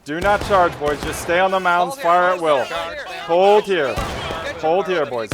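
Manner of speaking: game commander steadying the troops; battlefield commands. Pretend. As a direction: call the line to hold firm. Orders troops to hold position.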